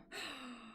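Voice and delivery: long breathy gasp; excited gasp. Real, not acted. Streamer realising there is room for VR, gasping. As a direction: suck in a soft gasp of realisation. Excited about new VR space.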